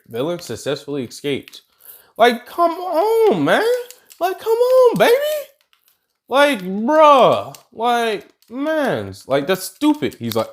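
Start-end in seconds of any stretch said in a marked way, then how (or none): 2.2-3.7 much higher, slow exasperated plea; mock exasperation at All Might. Exasperated high drawn-out version. 4.2-9.2 much higher exasperated whine; mock pleading at the hero.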